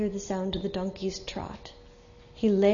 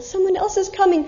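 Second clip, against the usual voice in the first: higher, louder hopeful call; 9 semitones higher, 7 dB louder than usual. Acted. Sick merchant calling to a traveler, louder hopeful call. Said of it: "he would call louder this time".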